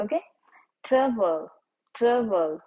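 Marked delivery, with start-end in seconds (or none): none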